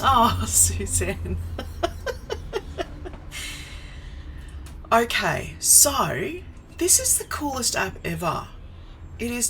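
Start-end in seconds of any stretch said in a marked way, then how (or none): 1.4-4.9 long, high helpless giggle; real laughter. Real, not acted. Streamer reacts to Suzanne's comment, real laughter. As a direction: laugh on and on, high and fairly soft.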